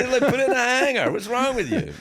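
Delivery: laughing at the obvious fix; laughing disbelief. Real, not acted. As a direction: tease him through laughter. Mocking friend's obvious mistake.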